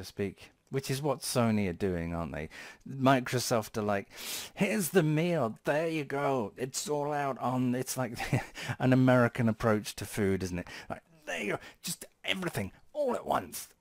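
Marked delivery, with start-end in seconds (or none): 4.5-7.7 high-pitched Microsoft serving up a meal; eager server. Acted. Acting out Microsoft serving food. 11.2-13.7 very high-pitched mock generous host; over-eager server. Acting out Microsoft heaping everything on.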